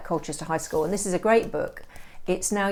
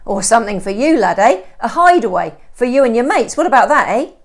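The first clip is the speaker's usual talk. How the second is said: louder, higher, jokey coaxing; chummy estate agent; 6 semitones higher, 13 dB louder than usual. Acted. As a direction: win the lad over with a nudge. Stone jollies the boy.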